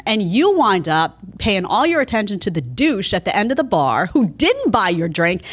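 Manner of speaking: ordinary delivery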